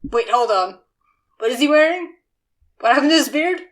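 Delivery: loud surprised double take; startled shout, then confused. Retold or imitated. Acting startled Briarwood.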